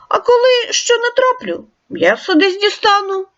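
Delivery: a touch of a hungry wolf; wolf character. Acted. Wolf voiced in tale.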